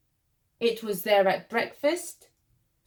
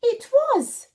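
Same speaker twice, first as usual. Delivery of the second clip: high, louder gasp of discovery; surprised reveal; 13 semitones higher, 6 dB louder than usual. A narrator's reading. Narrator to children, revealing the boy's worry, with high excited surprise. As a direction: It was! like a big discovery.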